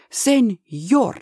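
put-on mild Spanish-accented cross address; a touch of anger in the Spanish accent; angry.

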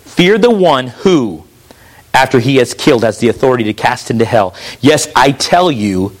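solemn reading, sinking very low; scripture reading. Acted. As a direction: read it as a grave warning, slowing on key phrases. Reads scripture aloud.

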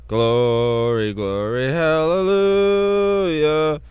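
ordinary talk, nothing marked

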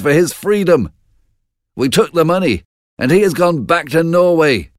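a messenger proudly bringing news; eager nobleman reporting victory, voiced a touch high; lord reporting. Ross reports to the king.

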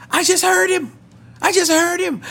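very high, squeaky imitation of an overcome woman; imitated tearful amazement. Woman telling the pastor of hearing God, imitated high tearful cry. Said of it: "tears began to pour from her eyes".

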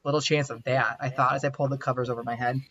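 reading her romance story aloud at an even pace; the author reads her own chapter to the writing group; story narration. Acted. Story narration read aloud.

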